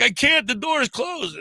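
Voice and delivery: high, panicky answer; a trapped kid calling back; scared reply. Retold or imitated. Boy in the back answering the driver, high and scared. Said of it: "He's like".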